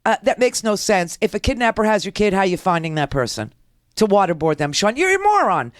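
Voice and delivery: ordinary talk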